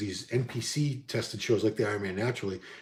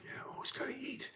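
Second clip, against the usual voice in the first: whispered aside; 17 dB quieter than usual. Real, not acted. Aside about always having to eat.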